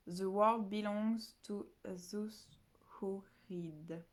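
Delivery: second careful go at an English quote; English accent. Shown as a demonstration. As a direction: try the English line again slowly. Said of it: "Je sais pas du tout si je le prononce bien".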